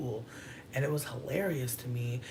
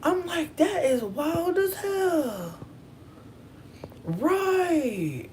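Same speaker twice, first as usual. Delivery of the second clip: high-pitched disbelief; 12 semitones higher, 3 dB louder, slower than usual. Retold or imitated. Shocked reaction, high pitched.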